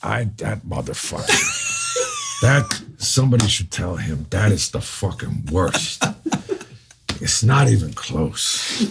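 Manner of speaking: slow, hammed-up indignant actor. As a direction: stretch out each word in mock outrage. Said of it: "all of a sudden he gets wound up".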